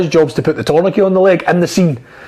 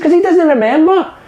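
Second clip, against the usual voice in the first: retold explanation; 8 semitones higher than usual. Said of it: "He says".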